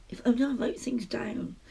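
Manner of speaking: breathy whisper to herself; whispered aside. Real, not acted. Self-mocking aside to viewers.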